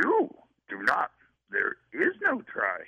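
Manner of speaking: put-on Jedi master impression; Yoda. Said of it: "let me do Yoda's voice".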